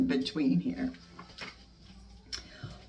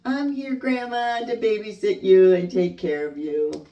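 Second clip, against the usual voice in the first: playful shout; 6 semitones higher, 5 dB louder than usual. Acted. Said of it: "you can shout".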